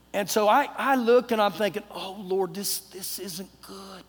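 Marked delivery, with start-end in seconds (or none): 1.9-4.0 soft, slow dismay; dismayed muttered prayer. Retold or imitated. Man dreading his seat between two strangers, quietly dismayed. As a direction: let it sink in quietly, trailing slower.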